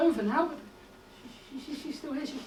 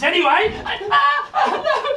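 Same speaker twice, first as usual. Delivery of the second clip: much higher, loud cackling laughter; manic laugh; 11 semitones higher, 14 dB louder, much slower than usual. Acted. Professor laughing madly at the maid. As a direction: burst into a crazed laugh.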